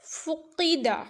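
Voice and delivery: teacher models فقیدہ with the throaty qaf; heavy qaf. Shown as a demonstration. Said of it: "یہاں پر موٹا قاف ہے".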